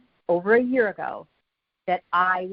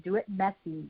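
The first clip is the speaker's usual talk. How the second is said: much softer, half to herself; softer aside; 8 dB quieter than usual. Real, not acted. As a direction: drop the volume, say it softly. Coach urging listeners to act.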